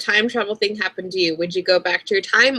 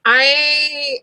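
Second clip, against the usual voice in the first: ordinary talk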